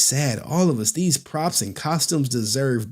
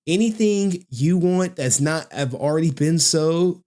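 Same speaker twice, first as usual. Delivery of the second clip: usual speaking voice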